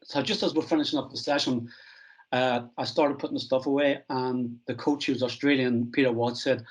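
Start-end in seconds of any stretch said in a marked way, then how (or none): none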